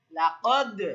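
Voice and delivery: loud, falling লাকদ with a bounced দ; emphatic লাকদ with a pushed release; qalqalah bounce. Shown as a demonstration. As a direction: let the final d of লাকদ echo briefly. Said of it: "কলকলা করতে হলে আমাদেরকে বলতে হবে".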